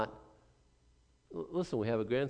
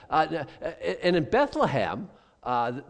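ordinary delivery

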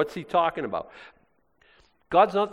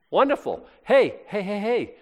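mock cheer; 7 semitones higher than usual. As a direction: play a cheering Corinthian. Voicing the worldly Corinthians.

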